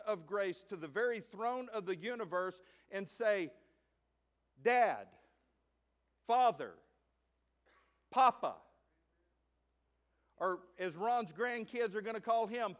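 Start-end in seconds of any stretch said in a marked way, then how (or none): 4.6-8.5 louder, each name set apart; calling out. Shown as a demonstration. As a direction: call each name out with pauses. Calls on God as Father.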